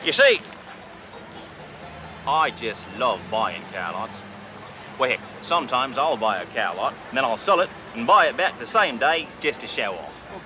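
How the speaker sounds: cocky Australian car dealer bragging to a salesman; fake Australian accent. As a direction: play the brash outback tycoon Crocodile Bruce. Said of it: "Name's Crocodile Bruce".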